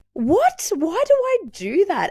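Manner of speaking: high, sudden startled question; shocked realisation. Retold or imitated. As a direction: catch yourself in disbelief. Self-quote, sudden realisation.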